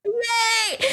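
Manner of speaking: high little yay; hammed-up high yay; mock cheer. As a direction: cheer like a thrilled kid. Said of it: "And he's like".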